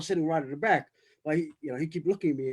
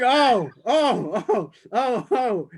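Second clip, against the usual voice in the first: higher, louder retold string of ohs; retold reaction; 7 semitones higher, 6 dB louder, much slower than usual. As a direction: act out your own reaction, pitched up and loud. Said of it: "I was going".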